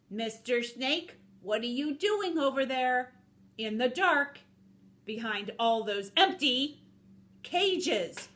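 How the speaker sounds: dawning realization, called out; suspicious wolf. Acted. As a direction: build to alarm on the last word.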